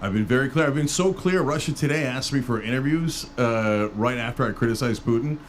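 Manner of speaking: normal talking voice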